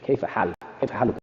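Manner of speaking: كيف حالك rattled off fast; كيف حالك said fast and casual. Shown as a demonstration. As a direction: toss off كيف حالك quickly like everyday speech. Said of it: "what's your condition".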